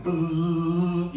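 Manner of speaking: mock special-effect buzz; buzzing sound effect. Preacher mimicking the angels' glow, a buzzing hum. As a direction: buzz like a light humming.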